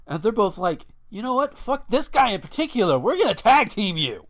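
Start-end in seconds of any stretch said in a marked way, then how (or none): 1.1-4.3 loud, high-pitched mock outrage; hammed-up fighting challenge; mock indignation. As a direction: play two fed-up brawlers teaming up. Two rivals turn on newcomer.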